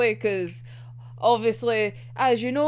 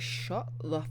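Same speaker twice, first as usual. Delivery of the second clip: deadpan Chuya; 6 semitones lower, 7 dB quieter, slower than usual. Retold or imitated. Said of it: "it's just like".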